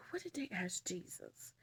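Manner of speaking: whispered to herself. Real, not acted. Narrator whispering to herself while recalling the Bible story. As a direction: whisper while trying to remember.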